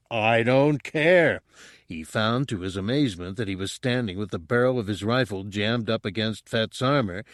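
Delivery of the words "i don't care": a louder, slow, drawn-out yell; screamed. A narrator's reading. Han screams at the bounty hunter, slow and loud. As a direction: throw the words out as a pained scream. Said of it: "Han took a step forward and screamed".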